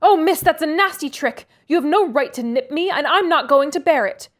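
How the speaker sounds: high, loud protesting cry; screamed out. A narrator's reading. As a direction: cry out, hurt and indignant. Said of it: "screamed out".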